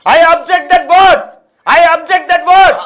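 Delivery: loud, high, drawn-out protest; forceful objection. Real, not acted. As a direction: object loudly, stretching each word. Heated debate, forceful objection.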